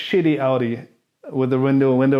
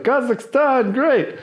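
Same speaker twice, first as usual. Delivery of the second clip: high cheery tourist; mock tourist voice; 10 semitones higher, slower than usual. Comic recapping his dumb tourist act, high and cheery. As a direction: chirp it cluelessly. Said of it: "who just goes like".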